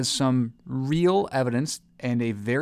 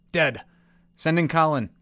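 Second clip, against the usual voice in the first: calling out; 5 semitones higher, 6 dB louder than usual. Acted. Doctor calls next expert.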